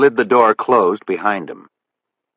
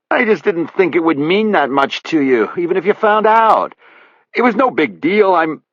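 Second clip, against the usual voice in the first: much higher, whiny pleading; 11 semitones higher, 4 dB louder than usual. A narrator's reading. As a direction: whine like a man begging forgiveness. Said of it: "that pleading, whiny voice".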